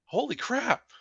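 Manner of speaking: high, hushed disbelief; retold astonishment at the recording; awed shock. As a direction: breathe it out up high, stunned. Reacting to ghostly voice.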